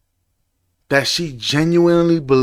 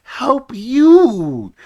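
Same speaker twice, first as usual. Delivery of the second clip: high, loud, crying movie line; mock tearful movie plea; movie quote; 6 semitones higher, 3 dB louder, much slower than usual. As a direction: wail it like the movie hero. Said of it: "He's like".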